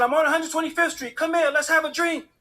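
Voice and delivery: high, loud, eager invitation; elated reply. Retold or imitated. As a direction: call it out over a celebrating crowd. Elated reply to friend by phone.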